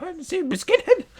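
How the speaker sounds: mock her grabbing at the hat, high; imitating her. Narrator mimics the woman tugging at his beanie in a high voice.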